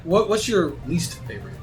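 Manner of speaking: ordinary talk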